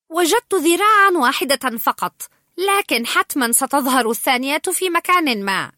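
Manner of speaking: high, loud, bubbling with excitement; excited. A narrator's reading. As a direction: gush with thrilled energy. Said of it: "قائلا بحماس".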